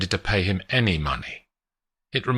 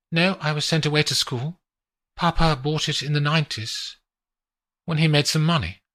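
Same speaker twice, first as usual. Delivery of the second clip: lightly voiced young woman, higher; a hint of a higher female voice; woman showing off the view; Adelina's voice; 7 semitones higher, faster than usual.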